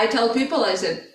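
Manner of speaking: ordinary delivery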